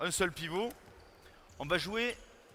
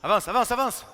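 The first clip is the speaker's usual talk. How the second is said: shouting instructions; 6 semitones higher, 11 dB louder than usual. Real, not acted. Coach yelling at defender.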